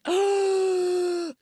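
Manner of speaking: long, high hammed-up gasp; sharp drawn-out gasp of mock shock; mock stunned gasp. Imitating Britt seeing who is there, mock stunned gasp.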